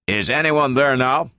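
mildly insistent general's query; demanding. A narrator's reading. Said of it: "demanded the general".

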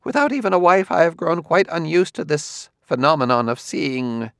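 a hint of a nervous scholar; Quemot's voice. A narrator's reading.

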